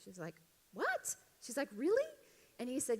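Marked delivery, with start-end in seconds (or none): 0.7-2.0 high-pitched, incredulous squeak; mock surprise. Flight attendant handed chocolates by a passenger, imitated high-pitched surprise. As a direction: act out a delighted, disbelieving reaction.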